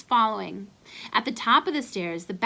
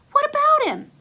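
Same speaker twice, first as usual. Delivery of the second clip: much higher and louder voice for Ace; friend's voice; 8 dB louder than usual. Acted. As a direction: pitch up as the eager friend.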